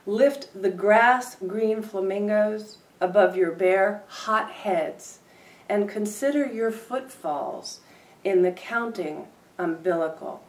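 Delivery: deliberate performance reading; poetry reading. Acted. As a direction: read it out like a poet at the mic. Poem reading, part three.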